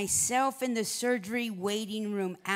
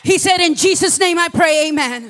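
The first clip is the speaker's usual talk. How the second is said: preaching at full force; 7 semitones higher, 13 dB louder than usual. Acted. Pastor preaches the doctor's prayer to the congregation, full force. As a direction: lift the room in a fervent prayer.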